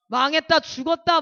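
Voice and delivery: loudly mimicked panicking man, swearing; panicked cursing. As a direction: shout out the despair of a cursing passenger. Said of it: "막 욕을 하기 시작하는 거예요".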